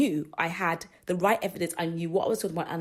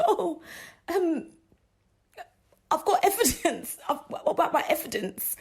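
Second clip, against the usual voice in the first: laughing disbelief; 8 semitones higher than usual. Retold or imitated. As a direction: protest through giggles, much higher. Retelling protest, laughing.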